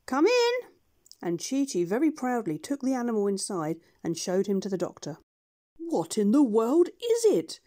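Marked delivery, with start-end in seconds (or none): 0.1-0.5 much higher, loud duck call. Acted. Duck calls the monkeys in while packing, high and loud. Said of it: "The duck, who was packing the trunk, said". 5.8-7.6 puzzled doctor. Acted. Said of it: "asked John Dolittle".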